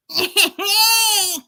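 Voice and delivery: high, loud imitation cackle; mocking fake laugh. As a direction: mimic a weird forced laugh. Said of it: "what is with that laugh".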